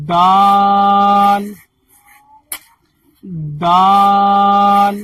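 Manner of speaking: "دال" stretched very slowly, tongue tip on the tooth ridge; slow model "دال" for learners; long drawn dal. Shown as a demonstration. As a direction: drag out every sound of dal. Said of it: "دال کا مخرج بھی تا کی طرح ہے".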